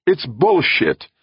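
high, loud, drawn-out objection; angry outburst. A narrator's reading. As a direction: burst out with it, stretching the words. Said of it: "Brandon burst".

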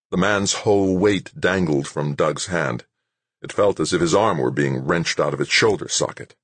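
ordinary delivery

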